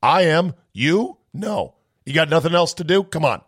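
normal talking voice

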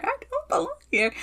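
high, fast, laughing cry; laughing release. Real, not acted. Client laughing high at the end of a release in therapy. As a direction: let the anger tip into a laugh.

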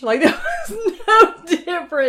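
high, loud, laughing disbelief. Real, not acted. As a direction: burst out laughing through the words. Laughing over unchanged accent.

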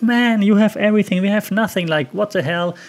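imitating resentful Easterners, indignant; exasperated complaint. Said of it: "And Eastern Germany would be like".

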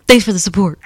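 loud mock tough-guy read; hardcore imitation. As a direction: ham it up, laughing through it. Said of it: "Is that how you said it?".

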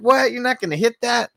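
high-pitched incredulous question; imitating an astonished pal; mock disbelief. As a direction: act baffled, pitched right up. Acting incredulous character offering weed.

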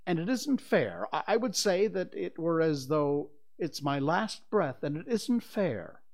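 high, indignant complaining; aggrieved protest. A narrator's reading. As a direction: protest like a wronged child. Father bursting, protests unfairness.

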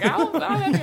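laughing through a high quoted question; quoted question broken by laughter; laughing quote. Retold or imitated. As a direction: giggle it out. Laughing while quoting herself.

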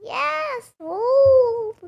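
voice changer. Pretend. Voice changer old voice.

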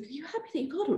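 high, soft, hushed voice; half-whispered question. Retold or imitated. Referee leaning on a player about his payback, imitated half-whisper. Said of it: "And I was kinda whispering".

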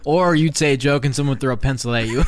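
usual speaking voice

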